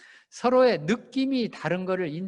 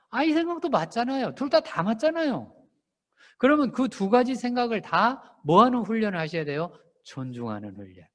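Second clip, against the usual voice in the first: normal talking voice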